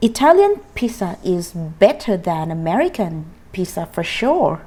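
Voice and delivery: very slow, word by word; slow drill model. Shown as a demonstration. Teacher leads students in a slow choral drill. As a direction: lead the class through it slowly. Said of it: "สาม สี่".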